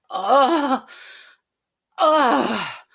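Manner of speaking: high, very loud cry of orgasm; climax cry. Acted. Climax during the story.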